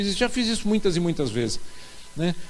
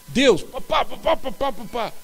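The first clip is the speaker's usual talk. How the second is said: pá pá pá said standing in for cusses, higher; mock bleeped swearing; 5 semitones higher, slower than usual. Young convert swears by habit in prayer, mock bleeped. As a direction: rattle off pá pá pá in place of swear words. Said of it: "e soltei um palavrão".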